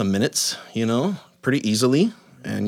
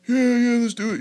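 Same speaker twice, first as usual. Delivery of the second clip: put-on high, bright kid voice, much higher; eager kids; 12 semitones higher than usual. Dad voicing his sons saying yes to the hot tub, high kid voice. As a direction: play the boys jumping at the idea.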